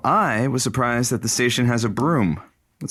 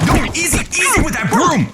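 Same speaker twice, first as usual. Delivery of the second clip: high and loud, pleading to stop; alarmed shout; 5 dB louder than usual. Acted. Frank waking up to Josie's broom, alarmed shout. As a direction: yell to stop the swinging broom.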